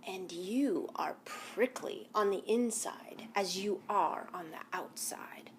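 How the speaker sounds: sly whispered coyote. Acted. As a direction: breathe it mysteriously.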